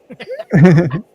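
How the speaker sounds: villain muhaha laugh; evil cackle. Pretend. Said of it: "can you give us a muhaha?".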